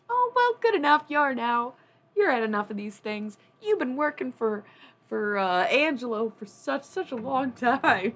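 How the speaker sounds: imitating relative. Relative declaring the friend Italian enough, loud and high. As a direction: gush like a family elder adopting her. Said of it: "And she's like".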